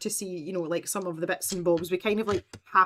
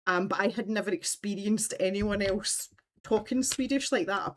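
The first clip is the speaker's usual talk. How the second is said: ordinary talk, nothing marked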